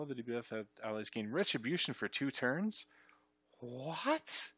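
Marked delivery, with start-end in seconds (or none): 3.6-4.5 high, drawn-out disbelieving what; a stunned, stretched-out double take; astonished. Real, not acted. Player stunned by the retribution text, astonished. Startled by what he just read.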